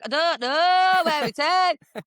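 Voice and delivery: hammed-up ditzy northern English, stretching the vowels; Danny. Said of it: "really ditzy anyway and really northern".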